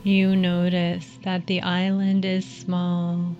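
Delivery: calm them down gently, unhurried. Acted. Leads a guided meditation.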